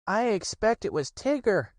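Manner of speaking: high, timid piglet voice; high little nervous guess. Acted. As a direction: Piglet afraid to say it. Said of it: "said Piglet nervously".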